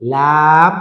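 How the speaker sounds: লাম loud, with a long vowel; drawn-out letter name. Shown as a demonstration. Teacher naming a letter for learners, drawn out. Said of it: "টেনে বলতে হবে".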